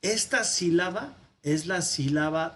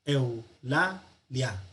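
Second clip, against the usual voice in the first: "Eu, la, lia" as three separate beats; three syllables; much slower than usual. Shown as a demonstration. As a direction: keep eu and lia whole in "Eu, la, lia". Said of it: "Son tres sílabas". ("Eu, la, lia" silabeado en tres partes)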